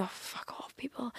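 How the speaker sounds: hushed muttered swear; whispered aside. Real, not acted. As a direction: whisper it under your breath. Muttered aside at online critics.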